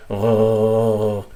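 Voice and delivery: long gargling gha at the top of the throat; gargled gha. Shown as a demonstration. Teacher comparing to morning gargles, gha drawn out as gargling. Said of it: "gargles in the morning".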